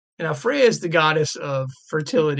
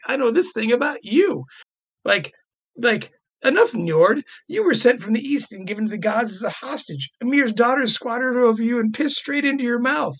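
Loki jeering; 7 semitones higher than usual. Retold or imitated. Loki jeering at Njord before the gods, mock glee. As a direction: gleefully needle him with dirt. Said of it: "Loki turns to that guy and says".

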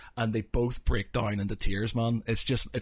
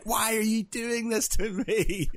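high, slow, wailing plea; playful high slow lament at the film; mock anguish; 10 semitones higher, slower than usual. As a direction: whine it like the film is torturing you. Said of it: "and you're like".

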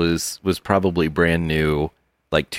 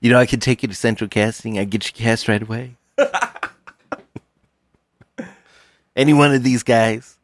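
Hollywood agent; 3 semitones higher, faster than usual. Acted.

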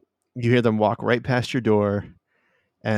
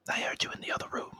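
fast, breathy unvoiced whisper; 14 dB quieter, much faster than usual. GM voicing a character whispering through the door, fast whisper. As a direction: hiss it quickly under the breath.

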